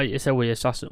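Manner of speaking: usual speaking voice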